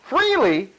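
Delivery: incredulous scoffing. Acted. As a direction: throw the word back scornfully. Scoffs at the quoted word.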